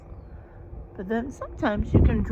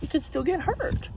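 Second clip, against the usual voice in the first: usual speaking voice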